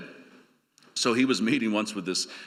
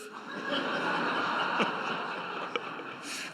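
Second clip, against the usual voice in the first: quiet laugh; 7 dB quieter than usual. Real, not acted. Laugh after the joke.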